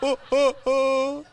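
high, hammed-up wail of joy; mock happy crying. Old man hugging a stranger at baby news, mock weeping. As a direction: imitate an old man bawling happily, slowly. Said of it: "He's crying, I'm crying".

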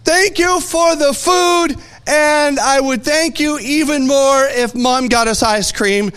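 put-on high, drawn-out little boy's prayer; loud proclaimed prayer. Five-year-old saying grace in a restaurant, loudly proclaimed. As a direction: belt out grace like a proud child. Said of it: "he loudly proclaimed his prayer".

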